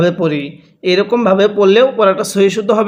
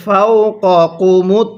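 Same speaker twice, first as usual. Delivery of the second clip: slow, drawn-out tajweed chant; Quran recitation; much slower than usual. Shown as a demonstration. Teacher demonstrates Quran reading to students, slow chant. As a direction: chant the verse slowly, stretching the vowels. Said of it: "কিভাবে পড়ব?".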